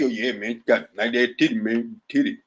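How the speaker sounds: a lowdown lecherous guest imitation; low mock interviewee voice. Host imitating a leering guest on his show, low and quick. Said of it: "I ain't gonna be".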